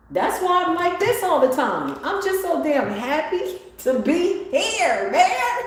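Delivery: high, slow, beaming delight; overjoyed. Real, not acted. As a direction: gush with happiness. Ecstatic after whoop.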